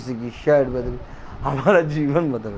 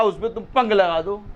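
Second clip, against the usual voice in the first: ordinary talk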